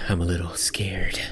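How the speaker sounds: hushed, low whisper of fear; playfully frightened murmur; mock scared. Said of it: "No, I'm just kidding".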